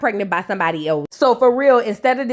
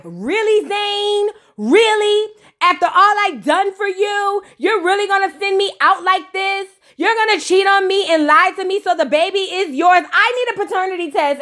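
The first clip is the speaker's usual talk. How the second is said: higher, lightly voiced hurt wife; a touch of the wounded wife, pitched up; betrayed wife; 7 semitones higher than usual. Vlogger mimicking the wife confronting Zane, higher.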